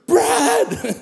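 high-pitched call across a room; imitated calling out. Lady hailing her old pastor across the room, imitated calling out. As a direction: call the name out like you've spotted an old friend. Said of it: "call across the room".

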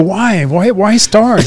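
retold puzzled question to the car builder; slow, drawn-out why. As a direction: ask why slowly, as if baffled. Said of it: "I go".

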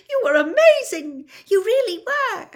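very high, loud, excited squeak; high-pitched emotional squeak; squeaky. A narrator's reading. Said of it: "Hermione said squeakily".

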